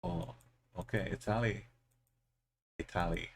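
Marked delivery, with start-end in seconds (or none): 1.1-1.7 "Italy" stressed on the middle syllable; altered Italy pronunciation. Shown as a demonstration. Player toying with the country's name, "Italy" stressed as ih-TAL-ee. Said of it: "You pronounce it Italy now". 2.8-3.3 "Italy" stressed on the middle syllable; altered Italy pronunciation. Shown as a demonstration. Player toying with the country's name, "Italy" stressed as ih-TAL-ee. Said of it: "You pronounce it Italy now".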